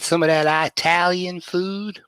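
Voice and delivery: imitated Southern local asking a question; imitated Southern accent. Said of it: "people that work with my wife".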